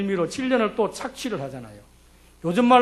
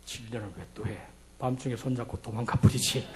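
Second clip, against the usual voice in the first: much lower and softer, half to himself; inner voice; 11 semitones lower, 9 dB quieter than usual. Retold or imitated. Preacher confesses his own thought to the congregation, low and hushed. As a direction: mutter it like a secret thought, chuckling. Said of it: "저는 마음속에".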